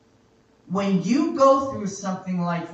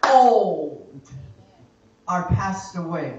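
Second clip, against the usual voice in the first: building preaching; 4 dB louder than usual. Real, not acted. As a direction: pile up the list, then punch it out. Preaches a rising declaration.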